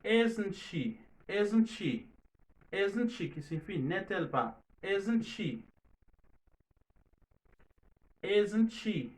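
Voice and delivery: "Isn't she" with the t dropped, like "izzn shee". Shown as a demonstration. Teacher drills the dropped T for the class: "Isn't she" with no t. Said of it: "Ou pa fè son T a soti".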